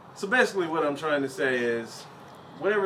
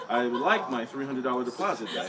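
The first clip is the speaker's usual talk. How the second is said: normal talking voice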